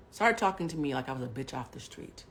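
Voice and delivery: ordinary talk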